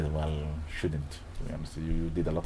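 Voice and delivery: low, soft inner voice; not loudly. Retold or imitated. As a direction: say it privately to yourself. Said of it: "talking to myself inside, not loudly".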